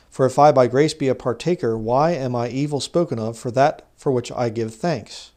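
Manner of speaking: loud, declamatory Bible reading; scripture reading. Acted. Reads scripture aloud to the congregation.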